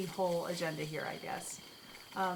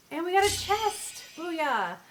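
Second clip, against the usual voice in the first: much higher and louder, triumphant; excited cheer; 8 semitones higher, 7 dB louder, slower than usual. Real, not acted. Streamer cheering after winning the chest, loud and high. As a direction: whoop of victory.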